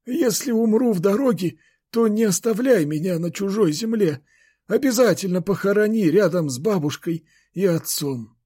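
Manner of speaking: put-on high old man's voice, solemn plea; grandfather. A narrator's reading. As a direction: make a dying wish, pitched well up. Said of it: "и тихо проговорил". (высокий стариковский голос, просьба)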